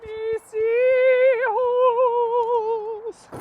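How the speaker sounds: very high, drawn-out wail; ghost wail. Pretend. Imitating La Llorona's wail to summon her. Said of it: "mimicking the ghost's wail".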